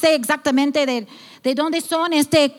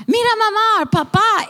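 put-on much higher, childlike call to mom and dad; child calling to parents; 8 semitones higher than usual. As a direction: play a small kid proudly calling mama and papa.

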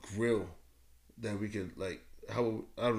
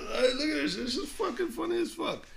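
laughing. Real, not acted. Laughing at comments.

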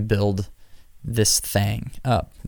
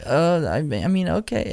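hesitant okay; 6 semitones higher than usual. Retold or imitated. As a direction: stumble into a reluctant okay, pitched up. His own hesitant reply.

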